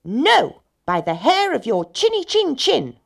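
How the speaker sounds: high, loud, defiant piggy voice; cried out. A narrator's reading. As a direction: shout the wolf away as little pigs. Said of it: "cried the pigs".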